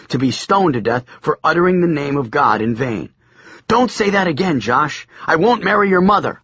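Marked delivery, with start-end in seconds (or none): 3.7-6.3 high, fast, panicky backing down; alarmed boy. A narrator's reading. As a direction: blurt it out in a scared rush. Biff frightened backing down.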